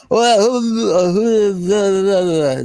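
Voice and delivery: put-on high, slurred drunken British babble; imitating a drunk, wobbly wail. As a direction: moan incoherently like a plastered British rocker. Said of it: "this, this sounding like [name]".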